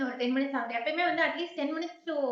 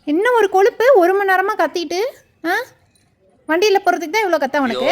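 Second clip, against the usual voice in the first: loud, higher-pitched telling-off; raised-voice complaint; indignant scolding; 5 semitones higher, 15 dB louder than usual. Real, not acted. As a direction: chide a cheeky pet at full voice. Scolding dog for barking so long.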